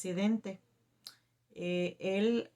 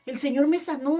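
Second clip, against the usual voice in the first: high, loud retelling of a healed woman's cry; imitated cry of amazement, raised and loud; retold tearful joy; 7 semitones higher, 7 dB louder than usual. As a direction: exclaim it as the overwhelmed woman, imitating her. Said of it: "empieza a llorar y a llorar".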